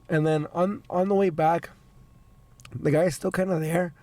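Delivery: ordinary delivery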